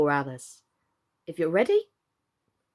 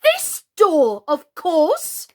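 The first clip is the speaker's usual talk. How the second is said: much louder and higher, exasperated; irritated shout; 8 semitones higher, 8 dB louder, much slower than usual. A narrator's reading. Alice loses patience with the frog, loud and irritated. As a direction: bark it out in irritation. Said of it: "almost stamped with irritation".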